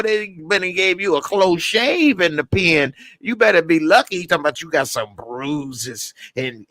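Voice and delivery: ordinary talk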